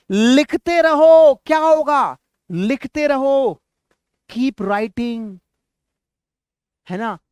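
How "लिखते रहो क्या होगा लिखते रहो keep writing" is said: high, loud classroom prompt; calling out. Shown as a demonstration. Teacher prompts students for the English, calling out. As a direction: throw it out to the whole class, high and strong.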